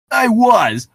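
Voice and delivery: voicing Streaks. S0 voices Streaks reply.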